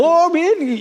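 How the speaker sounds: high excited call out; sudden high shout for help; crowd shouting. Retold or imitated. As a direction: call out over the chaos of the nets. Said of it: "la gente gritando".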